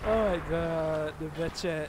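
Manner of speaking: slow, stretched exclamation; drawn-out dismay. Real, not acted. Commentator watching the Batchat in trouble, slow drawn-out dismay. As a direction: draw every word out in disbelief. Caster reacting to tank in trouble.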